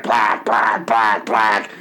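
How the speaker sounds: put-on a gruff caricature of an old president; imitating Nixon. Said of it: "Richard Nixon would be like".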